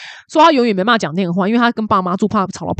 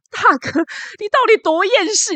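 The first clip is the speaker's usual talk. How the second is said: incredulous inner voice; 11 semitones higher than usual. Retold or imitated. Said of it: "我想说".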